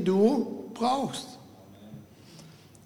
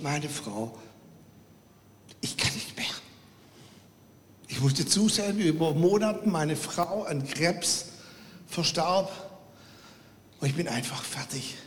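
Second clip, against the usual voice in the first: grieving widower; 4 dB quieter than usual. Retold or imitated. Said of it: "Und der Mann sagte".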